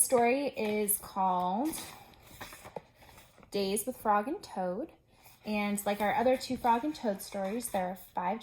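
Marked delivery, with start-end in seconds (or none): none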